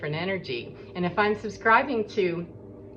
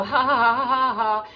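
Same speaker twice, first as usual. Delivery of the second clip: mock mean laugh; 5 semitones higher, 6 dB louder than usual. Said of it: "hop on board and go".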